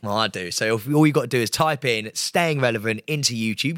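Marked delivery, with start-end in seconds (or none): none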